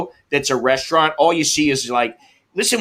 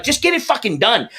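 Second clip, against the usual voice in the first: higher, exasperated snap; fed-up retort; 7 semitones higher than usual. Retold or imitated. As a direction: vent the frustration at the clerk.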